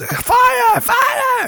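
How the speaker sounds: high-pitched shout raising a false fire alarm; mock alarm shout. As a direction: yell it like a prankster waking the house. Retelling his false fire alarm.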